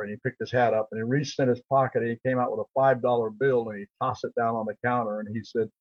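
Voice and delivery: ordinary delivery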